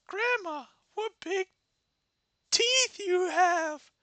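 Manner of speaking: put-on high girl voice, teeth slowed. Red noticing grandma's teeth, high slow girl voice. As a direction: drag out teeth like a wary little girl.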